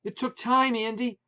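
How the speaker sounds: high, loud shout. A narrator's reading. Wife shouting news about the new dog, loud. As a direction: yell it over the dogs. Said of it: "Maureen shouted again".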